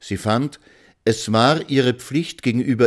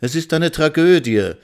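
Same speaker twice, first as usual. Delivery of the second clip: high, louder cry of dismay; exclaimed; 8 semitones higher, 5 dB louder than usual. A narrator's reading. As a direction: throw it out as a lament. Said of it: "rief Frau Miller aus".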